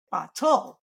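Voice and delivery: "bottle" as slow bah-TOLL, full t and long second syllable; misplaced stress. Shown as a demonstration. Therapist showing a child's prosody error, second syllable stressed in "bottle". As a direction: split "bottle" and weight its last syllable. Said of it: "they don't put the stress in the correct syllable".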